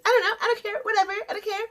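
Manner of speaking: high-pitched mock indifference; evasive brush-off. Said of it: "trying to hide and run away".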